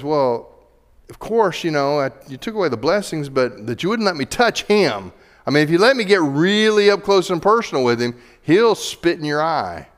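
mock Satan goading God, loud and quick; challenging Satan.

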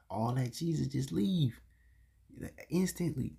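usual speaking voice

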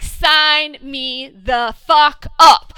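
slow, loud, word by word; emphatic eagerness. Retold or imitated. Fan relishing the character's coming punishment, emphatically eager. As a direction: pound out each word with relish. Retelling eager reaction to promised comeuppance.

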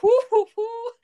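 high, loud teasing whoo-hoo; mock catcall. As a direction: hoot at him playfully. Catcalling ex-crush on campus.

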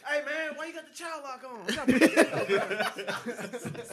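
loud, high, dragged-out laughing protest; mock complaint. Rider complaining to driver about child lock, hammed-up holler. Voicing rider shouting out the window.

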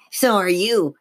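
high-pitched snarling dummy voice; angry growl. A narrator's reading. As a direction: growl it back like an angry puppet. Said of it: "his voice in a deep growl like an angry snarl".

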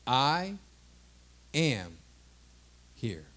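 "I", "am", "here" each set apart by a long pause; solemn, spaced-out "I ... am ... here"; weighty declaration. Acted. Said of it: "He said".

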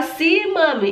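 put-on high little-kid reply; child answering mother. Son replying to his mother, high-pitched child voice. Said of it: "ou ele fala".

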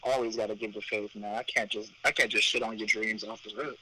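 ordinary talk, nothing marked

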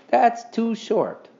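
slow, high-pitched complaint; object, dragging out the words; protesting. A narrator's reading. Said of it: "protested Henry".

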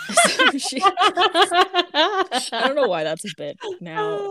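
high-pitched giggling through the words; cracking up and barely getting it out; laughing. Real, not acted. Cracking up mid-sentence.

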